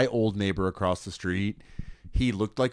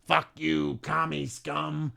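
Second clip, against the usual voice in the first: put-on old lady; 6 semitones higher, 5 dB quieter, much slower than usual. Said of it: "Picks up her cane like a rifle".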